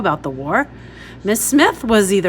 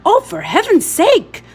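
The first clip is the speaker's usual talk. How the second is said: high, loud exasperated cry; exasperated woman; 11 semitones higher, 7 dB louder than usual. A narrator's reading. Stern woman scolding a fallen girl, exasperated and loud. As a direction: throw up your hands at the clumsy child. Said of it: "the iron-faced woman said".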